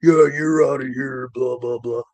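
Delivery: mock grumbling of hounding officials; hounding inspectors. Retelling two inspectors hounding him on site, mock hounding. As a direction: imitate inspectors hounding you. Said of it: "just sitting there hounding on me".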